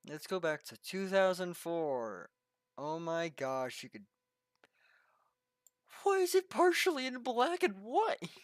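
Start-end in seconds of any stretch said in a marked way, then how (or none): 6.0-8.3 words pushed out on a burp. Real, not acted. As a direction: talk through a burp. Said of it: "I'm burping a lot".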